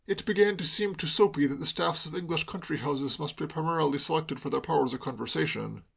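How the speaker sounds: ordinary delivery, nothing marked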